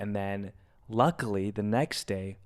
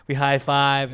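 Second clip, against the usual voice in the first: ordinary delivery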